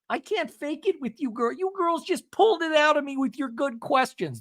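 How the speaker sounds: high, hammed-up confession; sustained high put-on voice; Seth Marks. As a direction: impersonate someone gushing out a secret. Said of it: "Starts sounding like Seth, Seth Marks".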